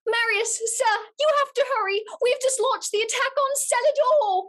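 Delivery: high, hurried Cockney messenger; urgent Cockney servant rushing Marius; Gustav's Cockney voice. Pretend. To Marius, Gustav hurries him in a high Cockney voice. Performing script scene as Gustav.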